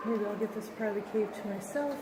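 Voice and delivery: normal talking voice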